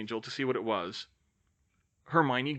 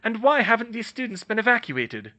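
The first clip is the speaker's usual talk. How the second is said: demanding; 10 semitones higher, 6 dB louder than usual. Acted. McGonagall demanding.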